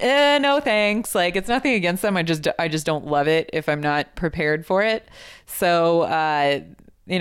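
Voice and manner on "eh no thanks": dismissive. Retold or imitated. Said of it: "I'm always like".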